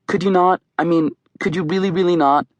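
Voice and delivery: asking kinda nicely. A narrator's reading. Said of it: "She's asking kinda nicely for her".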